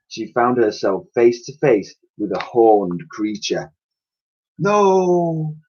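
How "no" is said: higher cry of fright; frightened no. Acted. Elsie facing a horned creature, frightened cry. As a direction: cry out as if about to be attacked.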